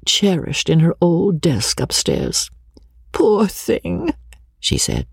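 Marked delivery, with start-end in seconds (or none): 3.1-4.1 a higher, pitying sigh of words; hint of a wistful companion; lightly voiced sympathetic Briggs. A narrator's reading. Briggs thinking of her old writing master, wistful pity.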